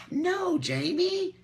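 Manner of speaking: high, slow, stretched-out scolding; drawn-out no. Real, not acted. Telling viewer Jamie she needn't worry, high drawn-out no. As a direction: let the words linger, half teasing.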